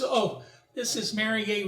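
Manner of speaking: ordinary delivery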